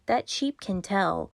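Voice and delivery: ordinary delivery, nothing marked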